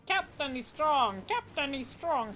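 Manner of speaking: high, loud squawking parrot; a hammed-up parrot repeating itself. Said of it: "I'm doing a parrot voice acting now".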